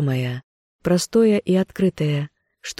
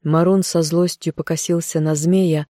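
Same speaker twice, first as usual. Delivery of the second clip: usual speaking voice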